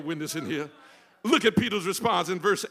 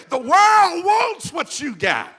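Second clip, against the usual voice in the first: much higher and louder, slow and drawn out; pulpit shout; 12 semitones higher, 13 dB louder, slower than usual. Real, not acted. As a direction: hurl it to the back row. Preacher's peak shout.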